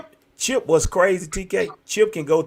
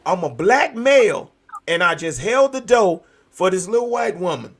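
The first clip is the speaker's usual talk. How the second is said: usual speaking voice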